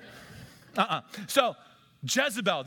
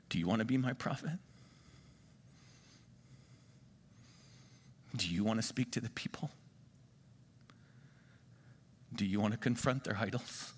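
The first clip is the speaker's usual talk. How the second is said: low, soft, solemn questioning; voice of Holy Spirit; 7 semitones lower, 9 dB quieter than usual. Acted. God calling a would-be prophet into the fire, hushed and solemn. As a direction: speak low and gently, with long pauses. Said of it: "And the Holy Spirit says".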